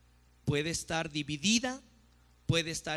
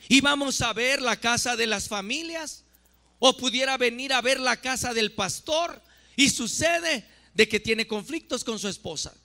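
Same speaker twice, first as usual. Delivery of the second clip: louder, higher, forceful preaching; prédica a toda voz; 8 semitones higher, 7 dB louder than usual. Real, not acted. (predicación enérgica a voz alta)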